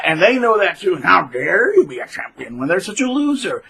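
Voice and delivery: ordinary talk, nothing marked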